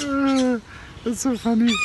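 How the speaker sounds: high, amused voice; words carried on a leftover laugh; still laughing. Real, not acted. Still laughing after punchline.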